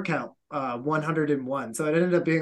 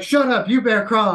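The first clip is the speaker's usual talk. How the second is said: loud, higher scolding shout; instructor yelling; 6 semitones higher, 7 dB louder than usual. Retold or imitated. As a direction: shout the laughers down. Instructors snapping at laughing recruits.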